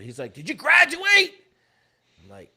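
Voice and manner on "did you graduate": high, loud interrogating yell; yelling officer. Retold or imitated. Warrant officer grilling the new guy, yelling. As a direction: fire the question out at a shout. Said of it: "He's just yelling at me".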